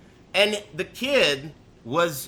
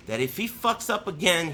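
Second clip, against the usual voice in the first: ordinary talk, nothing marked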